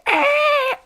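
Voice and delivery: a very high mock squeak; imitated squeal. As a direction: squeak like the panicking girl. Imitating the girl's cry.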